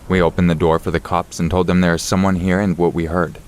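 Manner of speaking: plain reading voice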